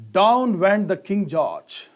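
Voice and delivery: DOWN said slow and loud, pushed to the front; stress on Down. Shown as a demonstration. Teacher reading an example sentence, stressing Down. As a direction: lean hard on Down, up high and slow. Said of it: "Look at this sentence".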